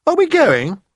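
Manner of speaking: put-on a disappointed girl asking; Sophie asking. A narrator's reading. Sophie to the BFG, asking if they're done.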